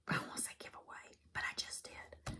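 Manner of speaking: a breathy secret whisper; whispered aside. Real, not acted. Vlogger whispering to viewers about a giveaway, hushed whisper. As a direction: let the word slip in a whisper.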